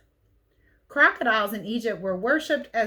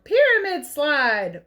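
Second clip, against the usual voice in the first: louder, drawn-out fun shout; playful yell; 6 semitones higher, 7 dB louder, slower than usual. Acted. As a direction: call it out like kids on a slide. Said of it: "Four little mummies yelled".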